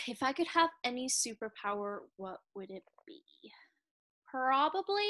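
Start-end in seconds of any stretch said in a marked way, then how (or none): 0.1-3.2 whispered, pondering to oneself; murmured musing, barely voiced; thinking aloud. Real, not acted. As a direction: drop to a near-silent whisper while thinking. Musing over question to herself.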